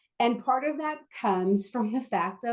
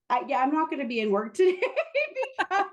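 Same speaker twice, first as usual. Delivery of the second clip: a sheepish excuse broken by laughter; high-pitched giggling excuse to the boss; laughing through an excuse; 7 semitones higher than usual. Retold or imitated. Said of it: "call my boss to tell my boss".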